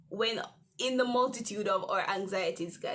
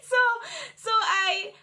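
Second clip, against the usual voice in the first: high laugh; 11 semitones higher, 8 dB louder than usual. Real, not acted. Laughing after story beat.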